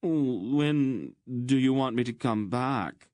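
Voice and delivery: high, slow pleading whine; plaintive, drawn-out question; whining. Acted. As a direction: whine like a rejected lover begging. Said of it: "he whined".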